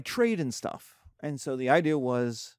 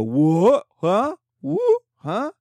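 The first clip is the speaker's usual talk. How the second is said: rising, louder squeaks of disbelief; baffled surprise; 9 semitones higher, 7 dB louder, much slower than usual. Retold or imitated. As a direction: play it as total confusion at the news. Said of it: "And you go".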